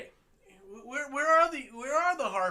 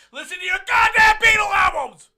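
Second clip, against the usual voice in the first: much louder, higher ranting yell; mock rant shout; 5 semitones higher, 13 dB louder than usual. Real, not acted. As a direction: blast the end of the rant. Ranting mockery of protesters.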